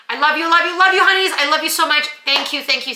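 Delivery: high, loud gushing love. Real, not acted. Vlogger gushing love to her subscribers. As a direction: squeal your love to them.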